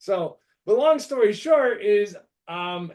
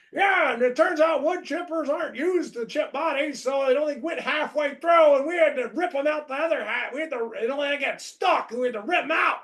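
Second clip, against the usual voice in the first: nonchalant gallows humor; 8 semitones higher than usual. Retold or imitated. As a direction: toss it off like it's nothing, but pitched way up. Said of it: "my buddy in his nonchalant gallows humor way is like".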